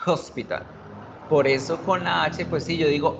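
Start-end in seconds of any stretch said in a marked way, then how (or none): 0.1-0.6 "hospital" with a clearly sounded jota at the front; H as Spanish J. Shown as a demonstration. Teacher points out the sound, "hospital" starting with J. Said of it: "a la J".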